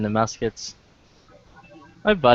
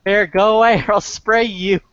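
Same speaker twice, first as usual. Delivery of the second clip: scaring off a bear; 9 semitones higher than usual. Retold or imitated. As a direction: yell high at the bear to leave. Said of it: "that girl yelling at the bear".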